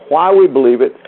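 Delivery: ordinary delivery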